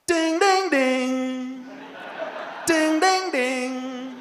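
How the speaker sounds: loud, high sung ding-dong doorbell; doorbell imitation. Shown as a demonstration. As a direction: ring out like a doorbell. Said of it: "sound a little bit more like this".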